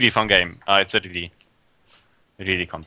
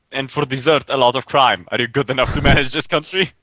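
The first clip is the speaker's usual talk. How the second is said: dramatic game-trailer read, a bit raised; 3 semitones higher than usual. Acted. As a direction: announce it like a movie trailer. Reads game blurb as trailer.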